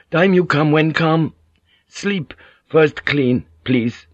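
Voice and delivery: foreign villager. A narrator's reading. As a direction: plead in halting broken English. Villager voiced in broken English dialogue.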